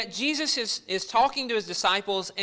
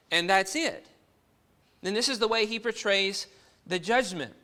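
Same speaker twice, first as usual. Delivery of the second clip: normal talking voice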